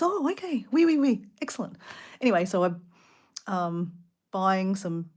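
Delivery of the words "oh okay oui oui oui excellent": high-pitched, mock-enthusiastic French shop worker; eager French oui-oui, retold; delighted approval. Fruit seller approving her photos of his fruit, imitated delighted approval.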